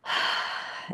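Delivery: soft unvoiced heavy sigh of defeat. Shown as a demonstration. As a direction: let out a weary breath. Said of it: "saying the negative".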